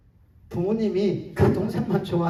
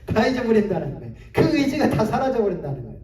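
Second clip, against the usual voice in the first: ordinary talk, nothing marked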